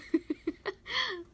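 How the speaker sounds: high real laugh; amused high giggle. Real, not acted. Laughs at daughter's crying.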